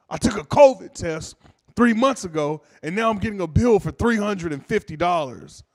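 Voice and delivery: loud, high complaint; hammed-up irate customer airing a grievance; angry caller. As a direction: complain indignantly down the phone. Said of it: "I'm pissed the fuck off".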